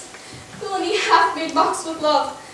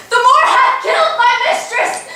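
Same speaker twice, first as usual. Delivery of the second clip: high, loud alarm cry; a maid raising the alarm; crying murder; 6 semitones higher, 5 dB louder than usual. Acted. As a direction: shout for help through the house.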